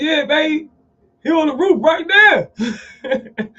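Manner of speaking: high, drawn-out excited bragging; proud dad on phone. Retold or imitated. Father telling his wife about his son, high and excited. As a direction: pitch it way up and stretch the ending. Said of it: "He on the phone with his wife".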